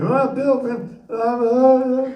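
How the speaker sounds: high, stammering mock interviewer; imitating the interviewer. The interviewer stumbling as he asks the astronaut a question. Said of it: "is interviewing".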